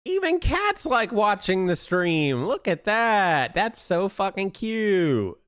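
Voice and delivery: excited gushing. Real, not acted. Gushing over cat.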